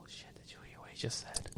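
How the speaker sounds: shocked gossiping whisper. Retold or imitated. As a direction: hiss it to the person next to you. Gossiping guests at the bar.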